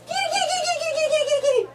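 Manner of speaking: very high sing-song call; high kitty call. Real, not acted. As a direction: squeal it like calling a cat. Calling to babies.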